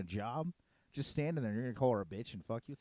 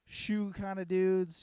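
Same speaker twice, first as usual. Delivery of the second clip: ordinary talk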